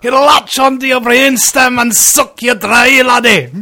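high, loud Scottish brogue; hammed-up Scottish menace; Scottish accent. Continues Scottish villain voice.

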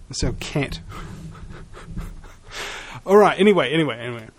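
ordinary delivery

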